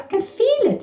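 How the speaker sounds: very high, thrilled quoted reply; squeal-high excitement, imitated; retold excitement. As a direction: imitate someone amazed at their own body. Retells client's excited reply mid-session.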